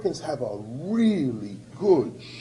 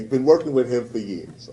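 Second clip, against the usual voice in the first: normal talking voice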